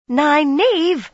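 high, loud irritated call; peevish. Acted. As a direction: snap her name in annoyance. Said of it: "Elaine said peevishly".

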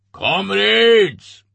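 booming official voice to the crowd; booming address. A narrator's reading. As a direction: boom it out to the whole station. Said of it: "addressed the people in a booming voice".

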